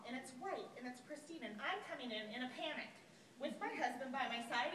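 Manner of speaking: usual speaking voice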